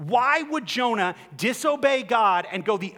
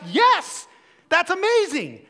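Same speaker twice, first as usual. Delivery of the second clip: delighted; 10 semitones higher, 5 dB louder, slower than usual. Pretend. Imagined delighted reaction.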